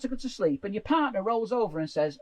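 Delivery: normal talking voice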